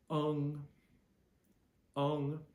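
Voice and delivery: UNG held high and level, like the end of "lung"; first tone. Shown as a demonstration. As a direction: sing out UNG on one high, steady pitch. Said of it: "the back part of the word lung".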